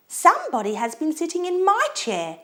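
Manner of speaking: high, sweetly exclaimed mother bear voice; sweet Mama Bear. A narrator's reading. Mama Bear sees flattened cushions, high sweet exclaiming. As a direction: exclaim it sweetly, well up in pitch. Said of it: "in her sweet low voice".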